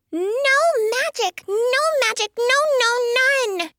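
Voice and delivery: very high, frantic bird voice; squawking protest. A narrator's reading. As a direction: squawk a flat refusal like a bird. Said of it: "Tweet squawked".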